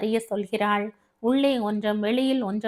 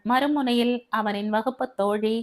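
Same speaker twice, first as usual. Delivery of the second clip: plain reading voice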